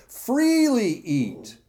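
FREELY high and drawn out; slow, high-pitched punch on FREELY; emphatic correction. Acted. Corrects the woman's misquote of God.

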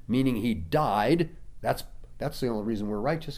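ordinary talk, nothing marked

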